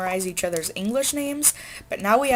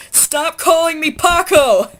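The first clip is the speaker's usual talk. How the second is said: much higher, louder, slow indignant yell; retold yell; 10 semitones higher, 12 dB louder, slower than usual. A classmate yells across Spanish class, indignant shout. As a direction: bellow it like a fed-up student. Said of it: "I just heard somebody yell".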